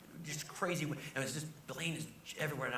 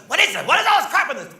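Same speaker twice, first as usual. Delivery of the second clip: much higher, loud incredulous Regis squawk; Regis impression; 19 dB louder than usual. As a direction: mimic Regis griping backstage. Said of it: "He said".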